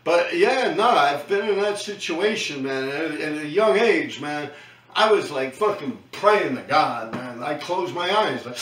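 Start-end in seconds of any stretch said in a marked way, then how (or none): none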